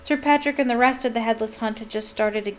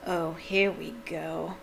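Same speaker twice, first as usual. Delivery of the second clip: slow, soft, weary bitterness; bitter; 3 semitones lower, 5 dB quieter, slower than usual. A narrator's reading. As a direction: grumble with resignation. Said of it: "said Nearly Headless Nick bitterly".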